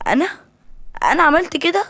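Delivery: high, loud, incredulous question; shocked. A narrator's reading. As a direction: ask it in shocked disbelief. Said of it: "آسيا قالت بصدمة".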